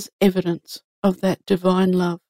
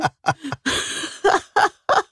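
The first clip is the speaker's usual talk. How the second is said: high amused laugh; 11 semitones higher than usual. Real, not acted. Still laughing at own joke.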